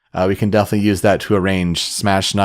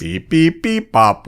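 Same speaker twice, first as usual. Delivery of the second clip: robot beeps; 7 semitones higher, slower than usual. Pretend. Imitating a glitchy robot voice.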